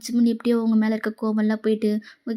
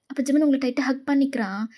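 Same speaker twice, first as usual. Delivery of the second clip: plain reading voice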